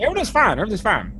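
high, emphatic repeat of "Everything's fine"; Buzz repeating himself. Acted. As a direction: say it clearly this time. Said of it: "I said, I said".

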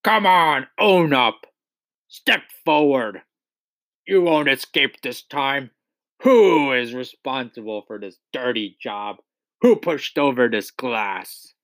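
angry demands in a put-on voice; roaring headmistress. A narrator's reading. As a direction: bear down on the culprit, drawing out words. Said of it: "she roared".

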